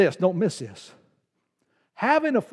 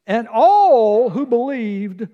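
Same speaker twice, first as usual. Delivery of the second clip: slow and louder; stressed phrase; 3 semitones higher, 5 dB louder, much slower than usual. Real, not acted. Pastor pointing out the scripture phrase, slow emphasis. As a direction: draw out all who believed. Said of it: "Now notice this phrase".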